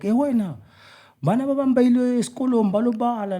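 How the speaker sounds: high-pitched mock scolding; imitated scolding parent. Player retelling parents telling off a schoolboy, high mock scolding. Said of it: "my parents used to shout at me".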